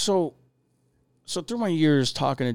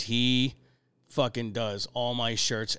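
usual speaking voice

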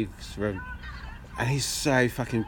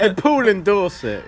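slow, louder, higher, tickled; amused echo; 5 semitones higher, 7 dB louder, slower than usual. Real, not acted. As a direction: draw it out through real amusement. Both laughing at the slip.

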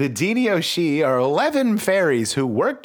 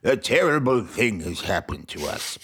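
lightly voiced king; 4 semitones lower than usual. King addresses the great hall.

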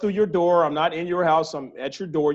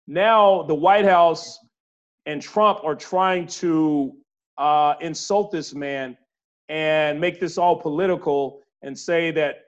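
slow indignant emphasis; slower than usual. Real, not acted. Indignant about Fauci being undermined.

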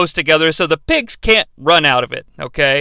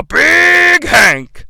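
ring announcer; 9 semitones higher, 3 dB louder than usual. Acted. Ring announcer-style intro.

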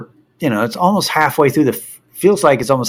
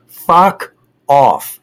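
loud, punched brush-off; acted-out irritated dismissal; retold angry dismissal; 3 semitones higher, 5 dB louder than usual. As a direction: order them away, re-enacting the anger. Retold outburst at seat grabbers.

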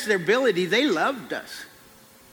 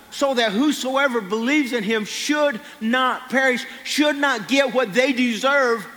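full-force preaching; 5 semitones higher than usual. Acted. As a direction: preach it to the whole church. Preacher builds to climax.

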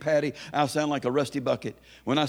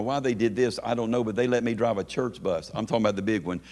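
ordinary talk, nothing marked

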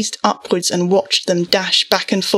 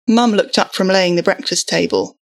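ordinary delivery, nothing marked

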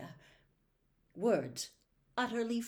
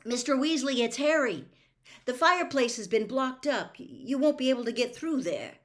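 raised, louder voice to carry through a wall; higher, projected warning; calling through boards; 5 semitones higher, 6 dB louder than usual. A narrator's reading. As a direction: call out an explanation. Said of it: "called through the boards".

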